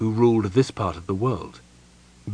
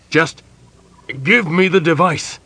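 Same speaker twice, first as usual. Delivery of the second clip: louder, insistent command; a pressing, louder order; urgent demand; 7 semitones higher, 6 dB louder than usual. A narrator's reading. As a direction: grab it back, no more waiting.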